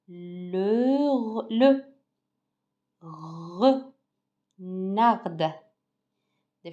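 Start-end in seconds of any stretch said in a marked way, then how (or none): 0.1-5.2 "renard" stretched slowly into re, nard with a gap; syllable by syllable. Shown as a demonstration. Teacher reading the word with pupils, "renard" slowly split into syllables. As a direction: sound out "renard" in long separate pieces.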